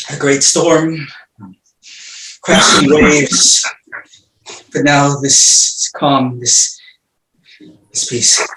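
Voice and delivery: hushed, dazed, fading murmur; dazed and quiet. Acted. Orestes waking from madness, exhausted.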